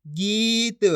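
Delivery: गीत blended with a long held vowel. Shown as a demonstration. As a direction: stretch the ई in गीत. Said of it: "इसी तरीके से पढ़ना". (गीत की ई खींचकर)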